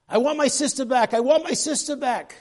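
shouted high and loud, twice, pleading; a boy screaming to get his sister back; desperate scream. Retold or imitated. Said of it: "and he screams".

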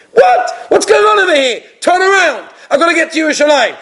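panic, then demand a U-turn; frantic yelp. Retold or imitated. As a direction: passenger yelling to turn the car around. Passenger panics, turns around.

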